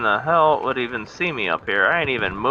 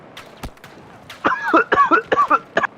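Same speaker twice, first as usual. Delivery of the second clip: coughing fit; 4 dB louder than usual. Real, not acted.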